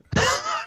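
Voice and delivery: high, short burst of laughter; incredulous laugh. Real, not acted. Podcaster mocking the idea of Westerns in Florida, incredulous laugh. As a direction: laugh at an absurd claim.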